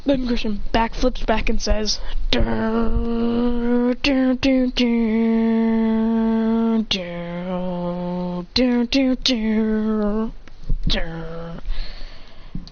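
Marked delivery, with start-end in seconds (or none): 2.3-11.6 droning dramatic theme imitation; mouthed soundtrack, long held notes; dun-dun sting. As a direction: hum out a suspense sting, note by note.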